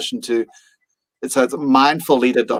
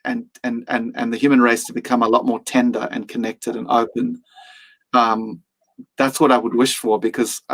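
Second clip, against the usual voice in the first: usual speaking voice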